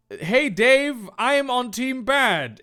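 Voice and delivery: villain's challenge. Acted. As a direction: announce yourself as the baddie. Kit Kat's line, first take.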